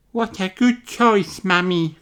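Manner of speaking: put-on high child voice; little Rebecca Rabbit voice. Rebecca telling the teacher her idea, high child voice. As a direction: offer it shyly.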